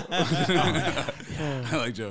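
slow, laughing, high mock drawl; laughing mock quote. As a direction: laugh through it like imitating him. Mocking Joe Exotic line, laughing.

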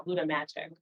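ordinary delivery

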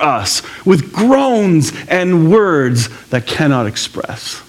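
slow, high, drawn-out reading voice; scripture reading. Acted. Pastor reading Romans to the congregation, slow and lifted. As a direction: lift the verse up slowly, with reverence.

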